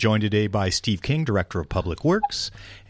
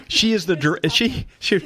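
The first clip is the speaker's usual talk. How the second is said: laughing; 10 semitones higher than usual. Real, not acted. Laughing after the name mixup.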